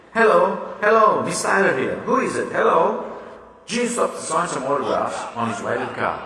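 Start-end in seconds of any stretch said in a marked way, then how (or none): 0.1-3.0 louder, higher calls into the receiver; anxious phone hello. Acted. Miss Tyler calling into a silent phone, anxious and loud. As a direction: urge someone to answer. Answering a dead phone line.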